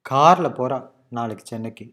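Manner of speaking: கார்ல punched at the start, the rest tailing off; stress on கார்ல. Shown as a demonstration. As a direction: focus hard on கார்ல, let நாளைக்கு சென்னைக்கு fall. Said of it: "to just focus on that".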